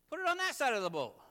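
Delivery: loud, high, quick shout; calling out. Acted. Stranger on shore advising the fishermen, calling out. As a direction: holler instructions out to the boat. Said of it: "if I called out to you".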